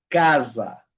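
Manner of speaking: "casa" with the S voiced as a buzzing Z. Shown as a demonstration. Teacher modelling S between vowels, bee-buzz S. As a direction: say "casa" like "caza" in English Z, stressed first syllable. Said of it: "un sonido como de abeja".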